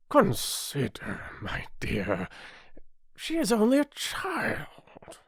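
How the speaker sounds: slow, hesitant pleading, rising high; timid King. A narrator's reading. King laying his hand on her arm, timid pleading. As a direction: plead timidly, drawing the words out. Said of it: "timidly said".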